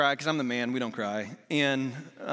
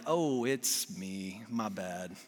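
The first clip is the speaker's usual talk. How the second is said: drawn-out, softer dawning realization; slow, sinking "my fault" delivery; sheepish admission; 7 semitones lower, 6 dB quieter, much slower than usual. Retold or imitated. Husband realizing he was the problem, sheepish.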